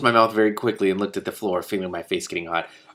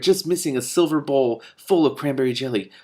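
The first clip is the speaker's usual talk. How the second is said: usual speaking voice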